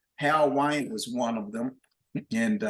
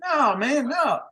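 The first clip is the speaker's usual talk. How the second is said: much higher, amused no; gleeful refusal; 12 semitones higher, slower than usual. Retold or imitated. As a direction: refuse to let it go, enjoying it. Bandleader refusing drummer.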